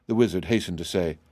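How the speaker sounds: ordinary delivery, nothing marked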